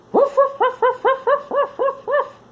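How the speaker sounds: high, loud yappy puppy barks; barking puppy. Acted. Lucky the puppy barking at the running children. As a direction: bark like an excited little puppy. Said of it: "barked Lucky".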